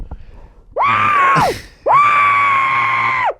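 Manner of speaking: high, loud sustained scream; scream into a pillow. Shown as a demonstration. Showing a friend how to scream into pillows, full scream. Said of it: "I like to scream into pillows".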